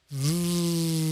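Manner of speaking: soft, steady v held out; long steady v buzz; sustained v. Shown as a demonstration. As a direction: hold an even quiet v. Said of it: "out to a v sound".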